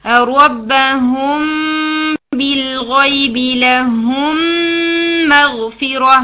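very slow, melodic tajweed recital; sung Quran recitation. Shown as a demonstration. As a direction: chant the verse slowly, holding each ghunnah. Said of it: "تو اس طرح سے".

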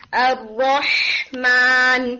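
الرحمن slowly, the ح of الرحمن held steady; a slow, careful الرحمن. Shown as a demonstration. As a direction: keep the ح in الرحمن still and long. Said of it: "رح کر کے نہیں".

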